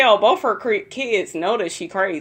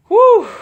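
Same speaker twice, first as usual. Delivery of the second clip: loud, high whoop of a whew; big exclaimed whew; 9 dB louder than usual. Real, not acted. Vlogger overwhelmed by crazy episode, loud high whew.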